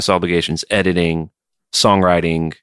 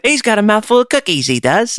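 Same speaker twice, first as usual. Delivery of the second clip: high, squeaky fake British; hammed-up British mimicry; bad British accent. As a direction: do a terrible British accent, pitched way up. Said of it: "I have the worst British accent".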